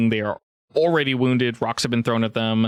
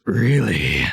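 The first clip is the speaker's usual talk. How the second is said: delighted ogre; 4 dB louder than usual. Acted.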